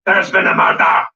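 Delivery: loud, slow Scottish proclamation; hammed-up Scottish accent, drawn out. Shown as a demonstration. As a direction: announce it in a big Scottish accent. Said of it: "Obviously, the Scottish accent".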